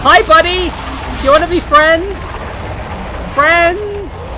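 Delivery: high, loud, over-eager greeting; mock-friendly calling out. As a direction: call out to a ghost like a new best buddy. Calling out to the game's ghost.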